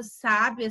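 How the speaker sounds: sábia with a strong SÁ; first-syllable stress. Shown as a demonstration. Teacher contrasts lookalike words, stressing SÁ in sábia. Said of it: "pra gente tá evitando aí a ambiguidade".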